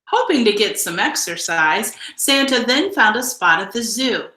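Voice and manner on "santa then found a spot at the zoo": usual speaking voice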